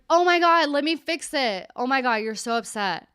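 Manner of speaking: high, mock-panicked fussing; overeager rescuer. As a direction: act out rushing to fix someone's upset. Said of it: "you wanna be like".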